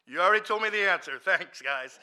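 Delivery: loud, high sarcastic thanks. Retold or imitated. Thanking officers who shut up, loud sarcasm. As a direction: toss it out loudly, mock-grateful. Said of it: "I'm sarcastic".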